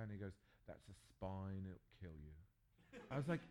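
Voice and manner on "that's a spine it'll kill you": slow, much lower and softer matter-of-fact Aussie host; deadpan warning. Retold or imitated. Australian host warning the pastor about the bird, deadpan. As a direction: drop it low and slow, no alarm at all.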